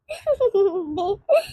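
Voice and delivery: soft stifled chuckle; quiet laugh. Acted. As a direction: laugh under your breath. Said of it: "मुझे हंसी आ रही है वैसे". (दबी हुई हंसी)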